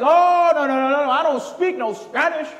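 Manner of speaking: loud, high student denial; mock protesting student. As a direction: blurt a hammed-up refusal. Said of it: "And he goes".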